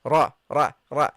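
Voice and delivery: "Ra" full and heavy from the back; deep round heavy "Ra". Shown as a demonstration. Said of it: "It's heavily pronounced".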